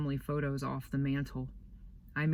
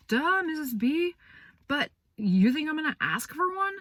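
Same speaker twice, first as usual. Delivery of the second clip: put-on sarcastic teen; 12 semitones higher, 5 dB louder than usual. Boy answering the teacher with sarcastic duh. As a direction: roll your eyes at her.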